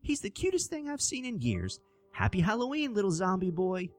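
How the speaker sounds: put-on high, sweet old lady voice, far up; a delighted old woman cooing at a child; elderly woman. A narrator's reading. Old woman at the door charmed by the zombie boy, high cooing voice.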